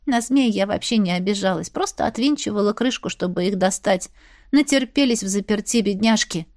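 ordinary delivery

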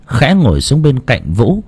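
usual speaking voice

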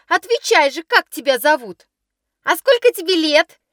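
high, sneering teasing voice; mocking schoolgirls. Schoolgirls taunting the new pupil, high mocking sneer. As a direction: jeer at the new girl in a high voice.

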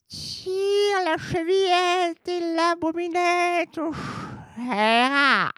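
high, slow, sugary wolf disguising himself; softened wolf voice. Acted. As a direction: coo it sweetly like a wolf faking granny. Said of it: "en adoucissant sa voix".

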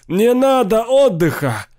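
high, loud, slow wail of refusal; frantic howl, pitched far up; desperate yell. A narrator's reading. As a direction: scream it against being made to rest. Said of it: "завопил Сейл".

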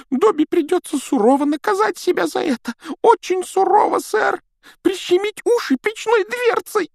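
very high, squeaky elf voice; eager little house-elf, squeaking. A narrator's reading.

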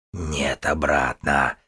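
низкое шипение дикой твари; прошипи сквозь клюв. A narrator's reading. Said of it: "прошипел он". (шипящий голос существа)

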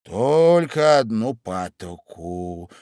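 very slow, pausing Dormouse; thoughtful Dormouse. A narrator's reading. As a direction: ponder each word slowly. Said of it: "подумав".

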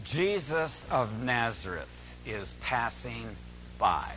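very soft, low, with pauses; hushed repetition. Shown as a demonstration. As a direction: let each phrase sink in, softly and low. Repeats the important statement, hushed.

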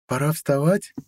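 just stirring from sleep, voice lifted; waking girlfriend. A narrator's reading. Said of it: "Сладко потянулась та ото сна". (голос просыпающейся девушки, повыше)

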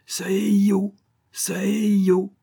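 loud, very high squawk; piercing bird cry, drawn-out ending; howling parrot. A narrator's reading. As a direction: screech it like a frantic parrot.

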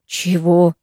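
understated surprise; a drawn-out, puzzled question; surprised. A narrator's reading. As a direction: react with mild astonishment. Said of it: "удивилась Ира".

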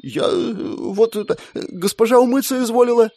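high, halting timid stammer. A narrator's reading. Squire Linda stammers excuse to sergeant, timid. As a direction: stumble over the excuse. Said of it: "запинаясь, пробормотала оруженоска".

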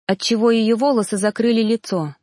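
usual speaking voice

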